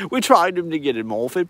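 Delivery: usual speaking voice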